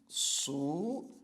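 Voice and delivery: "Subitem" said slowly as su-bi-tem; split into syllables. Shown as a demonstration. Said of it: "a divisão silábica vai ser assim". ("Subitem" separado em sílabas, devagar)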